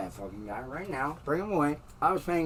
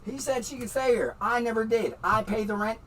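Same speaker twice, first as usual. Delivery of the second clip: ordinary talk, nothing marked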